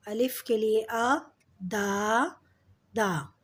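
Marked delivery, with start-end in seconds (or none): 1.6-3.3 दा, दा said apart with a long 'aa'; teacher's drawn-out 'aa' in दा, दा; long open vowel. Shown as a demonstration. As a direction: sound out दा, दा syllable by syllable. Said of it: "दादा में अ का sound आ रहा है".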